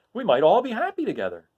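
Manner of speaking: high, hopeful Scarecrow voice; lightly voiced straw man, wistful. Scarecrow hoping they stay together. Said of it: "continued the Scarecrow".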